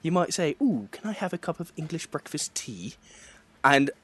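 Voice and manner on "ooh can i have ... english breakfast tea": higher, soft Southern English tea order; softly mimicked Southerner from England; Southern English impression. Shown as a demonstration. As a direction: put on a dainty Southern English accent. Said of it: "do an impression of a Southerner for me".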